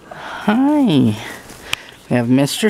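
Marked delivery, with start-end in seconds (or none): 0.1-1.2 high, sweet hi; puppy coo. Real, not acted. Greeting puppies.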